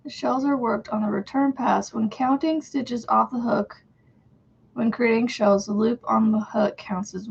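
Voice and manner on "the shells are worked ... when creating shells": usual speaking voice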